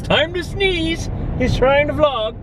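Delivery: high, slow cartoonish body voice; squeaky mock inner voice; voice of his body. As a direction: play his body mocking him, drawn out and high. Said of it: "my body goes".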